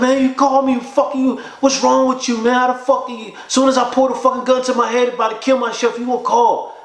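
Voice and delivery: high-pitched distraught retelling; imitated tearful outburst. Suicidal friend upset at caller's interruption, imitated tearful outburst. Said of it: "He was crying".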